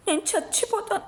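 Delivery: very high anguished scream. A narrator's reading. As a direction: shriek it in despair. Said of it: "అంటూ అరుస్తూ".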